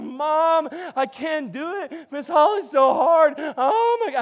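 hammed-up childhood meltdown; mock panicked wail. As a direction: imitate a distraught kid whining. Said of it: "But I cried. And I went home".

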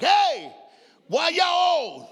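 put-on high, loud kid's shout; yelled to the front seat. Boy in back seat shouting at parents, loud and high.

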